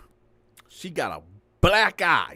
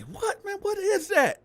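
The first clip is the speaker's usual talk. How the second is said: startled. Retold or imitated. Landlord hears the argument.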